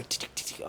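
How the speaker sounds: whispered tick-tick of claws on the ground; dog claws ticking. Retold or imitated. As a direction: mimic the dog's claws on the grass, whispered. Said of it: "I hear the dog just go".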